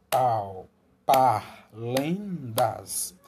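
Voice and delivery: syllable by syllable. Shown as a demonstration. Teacher showing a mother how to clap syllables, spaced syllables. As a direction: split "parlendas" into claps: Pau, par, lendas. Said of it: "ler a palavra pausadamente, batendo as palmas".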